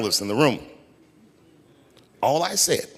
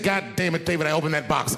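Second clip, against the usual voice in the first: retold mad wife; 5 semitones higher than usual. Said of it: "she was mad at me".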